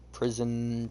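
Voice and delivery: ordinary talk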